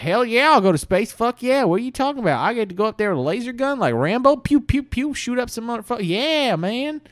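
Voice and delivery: high, loud whooping Southern yahoo; excited Alabama good-old-boy voice. Acted. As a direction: hype yourself up like a gun-happy local. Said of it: "a lot of them were like".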